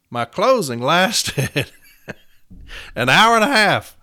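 loud, high, drawn-out boast; amused exclamation. Real, not acted. Telling colleagues how long his closing ran, amused exclamation. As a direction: exclaim it slowly, building to a loud high finish.

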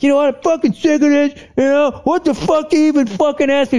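high, fast, fed-up rant; mock asshole outburst. Husband's imagined blow-up at wife, mock angry rant. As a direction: act out blowing up, pitch way up. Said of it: "I just wasn't an asshole".

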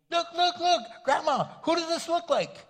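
put-on high, loud, excited little girl; granddaughter's voice. Granddaughter teasing grandpa, high excited child voice. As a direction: squeal it like a giddy six-year-old. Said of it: "she would go".

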